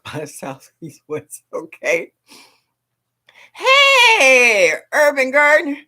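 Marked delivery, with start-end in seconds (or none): 3.5-5.8 loud, high shout-out; excited call. Real, not acted. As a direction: call out to the host across the room. Calling out a greeting.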